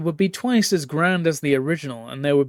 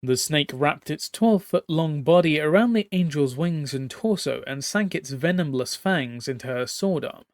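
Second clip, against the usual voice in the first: usual speaking voice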